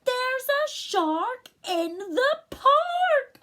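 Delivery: very high, slow, loud shout; child yelling in alarm; loudest voice. Acted. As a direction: cry it out as loud as he can. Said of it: "In his loudest voice".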